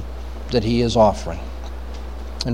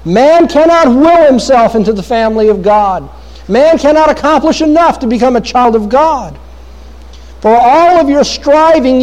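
higher-pitched, insistent pulpit delivery; forceful preaching; 7 semitones higher than usual. Real, not acted. Preacher telling listeners birth cannot make them God's children, forceful preaching. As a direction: press each point home to the congregation, pitch held high.

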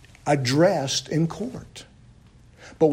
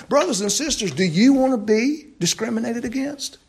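higher and louder, pressing each question faster; preaching at full force; 6 semitones higher, 8 dB louder than usual. Acted. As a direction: hammer the questions at the congregation.

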